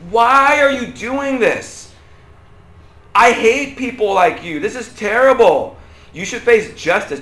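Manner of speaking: high, loud, drawn-out indignation; outraged condemnation. Acted. Friend condemning an open adulterer, outraged. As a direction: act out a scolding, leaning on each question. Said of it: "you'd be like".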